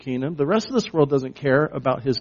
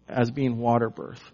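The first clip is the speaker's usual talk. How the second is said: usual speaking voice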